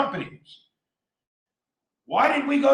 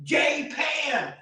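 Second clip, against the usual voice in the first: ordinary delivery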